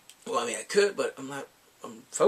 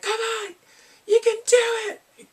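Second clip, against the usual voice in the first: much higher and louder, slow cheering; high fan cheer; 10 dB louder, slower than usual. Retold or imitated. Retelling commenters cheering the hero toward his woman, in a loud high squeal. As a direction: squeal it like a fan urging him on.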